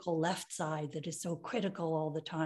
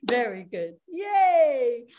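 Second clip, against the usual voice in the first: cheering; 4 semitones higher, 6 dB louder, slower than usual. Shown as a demonstration. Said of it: "cheer after exercise".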